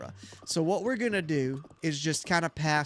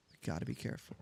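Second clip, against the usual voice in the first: barely voiced, softer still; softer whisper; 6 semitones lower, 12 dB quieter, much faster than usual. Real, not acted. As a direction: drop to a near whisper. Sneaking up on bird.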